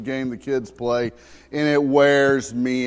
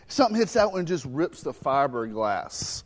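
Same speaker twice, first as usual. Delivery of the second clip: ordinary talk, nothing marked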